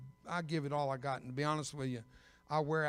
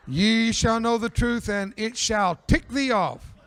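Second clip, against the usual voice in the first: slow, much louder and higher, King James proclamation; booming mock scripture; 6 semitones higher, 12 dB louder, slower than usual. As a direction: boom it out slowly like a pulpit verse for the joke. Mock scripture proclamation, preacher's punchline.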